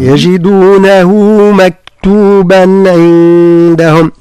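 slow, drawn-out tajweed chant; Quran recitation. Shown as a demonstration. Teacher reciting a verse to his student. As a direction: chant the verse slowly, each letter given its due.

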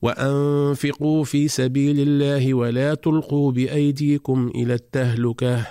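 chanted verse that comes to a full stop on التهلكة; chanted recitation with a pause; stop at al-tahlukah. Shown as a demonstration. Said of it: "فنقرأ".

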